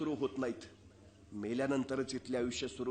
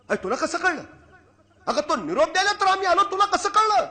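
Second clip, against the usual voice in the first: loud, high, stunned exclamation; the king is thrown, asking again and again; astonished king; 14 semitones higher, 10 dB louder than usual. Acted. King startled by mother's knowledge. (चकित राजाचा मोठा उद्गार)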